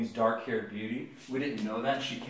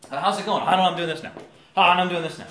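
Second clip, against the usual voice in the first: louder, raised-pitch flurry; hammed-up heedless person bouncing between things; scatterbrained; 5 semitones higher, 10 dB louder, much faster than usual. Mimicking people who don't walk circumspectly, scatterbrained.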